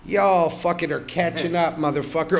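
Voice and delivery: ordinary delivery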